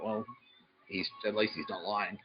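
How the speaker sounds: ordinary talk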